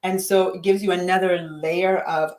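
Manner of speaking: normal talking voice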